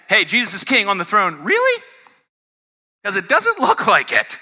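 high-pitched incredulous challenge; mock disbelief. Preacher voices doubts that Jesus is king, incredulous. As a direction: leap high into a sceptical question.

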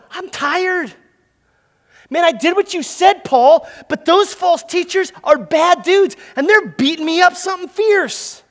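a beaten fighter begging his corner; weary Timothy. Retold or imitated. Timothy voiced, exhausted plea.

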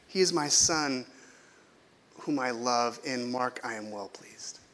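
put-on gently, like blessing each child; God proudly naming his beloved children, soft and slow; tender God voice. God speaking lovingly over listeners.

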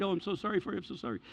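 soft, hushed condolence; very polite. Retold or imitated. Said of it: "they're very polite".

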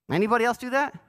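normal talking voice

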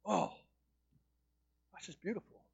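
soft awe. Pretend. Awed at a sunset.

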